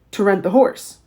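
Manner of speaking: usual speaking voice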